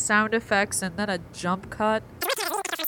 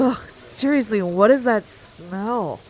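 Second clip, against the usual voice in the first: disgusted by smell; 5 dB louder, slower than usual. Acted. Reacts to foul smell.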